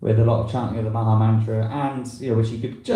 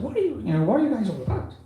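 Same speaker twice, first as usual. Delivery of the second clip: put-on high-pitched voice of a little old Irish lady, asking gently; 12 semitones higher, faster than usual. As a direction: lift right up into a curious old lady's voice. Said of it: "I wasn't trying an Irish accent then".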